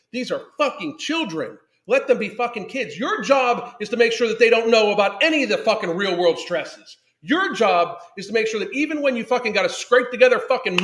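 high, loud, heated lecturing; real angry rant. Real, not acted. Lecturing parents about their job, angry and loud. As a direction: drive it home with rising outrage.

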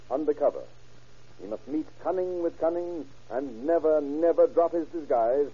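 normal talking voice

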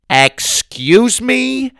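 drawn-out, high affronted question; indignant snap. A narrator's reading. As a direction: snap back, deeply offended. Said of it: "Grandma snapped".

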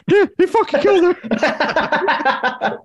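high, slow laugh-through line; squeaky laughing repeat. Real, not acted. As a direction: giggle your way through it.

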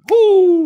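loud, high drawn-out whoop; imitated shouted "whoo"; coach's whoop. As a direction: whoop high and long, mimicking the old coach. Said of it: "snaps his fingers and goes".

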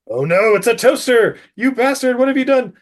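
high, hammed-up villain fury; overplayed shock turning to anger, pitched high; mock outrage. Doctor finds toaster in suitcase.

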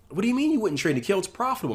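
high-pitched, disbelieving trader; incredulous protest. Retold or imitated. Traders questioning his refusal to trade a profitable strategy, imitated incredulous protest.

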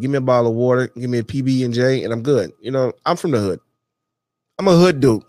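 ordinary delivery

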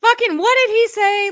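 high, loud, disbelieving yelp; shocked outburst. Real, not acted. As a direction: blurt it out in disbelief at what he just heard. Reacting to the Biden clip.

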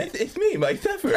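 high, lisping caricature; Mike Sheffer. Acted. Caricature of a co-host, high and laughing. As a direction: squeak it out with a lisp. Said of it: "a caricature of Mike Sheffer".

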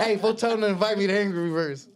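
high, loud mock-eager shout; excited imitation. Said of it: "Robert got all excited right now".

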